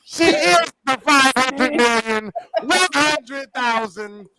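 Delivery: slow, high, big hype call; hype announcer. Acted. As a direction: announce her like a star. Emcee hype for the fan.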